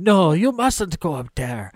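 would-be Russian that comes out Scottish; Scottish-sounding Russian warning; botched Russian accent. Acted. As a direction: attempt a Russian innkeeper and land in Scotland. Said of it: "why my Russian sounds Scottish".

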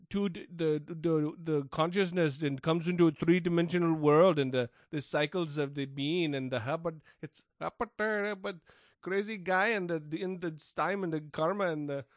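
put-on light Indian accent, rambling spiritual jargon; a loose Indian accent, tossing out mystic buzzwords; Deepak Chopra Indian accent. Podcaster mocking Deepak Chopra's word salad, light Indian accent. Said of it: "It's an Indian accent".

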